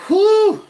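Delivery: a high, loud blown-out whew of heat. Real, not acted. Overheated on stream, taking her coat off, loud high whew.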